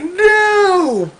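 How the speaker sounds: high, cried-out no; a high despairing cry; anguished no. Retold or imitated. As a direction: wail the no in despair. Voicing Korra's anguished cry.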